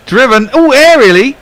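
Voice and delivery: rising, shouted excitement; excited commentary. Real, not acted. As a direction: call the shot in a burst of excitement. Live commentary on an aerial drive.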